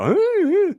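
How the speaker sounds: very high, loud mock squeal; playful falsetto whoop; high-pitched squeal. Shown as a demonstration. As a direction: squeak way up high, mocking your own voice. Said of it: "Went really high pitched then".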